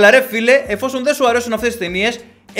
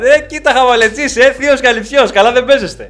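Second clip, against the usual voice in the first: louder, higher mock praise for the uncle; mock amazement; 5 semitones higher, 7 dB louder than usual. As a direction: cheer the uncle on in jest. Loud mock admiration.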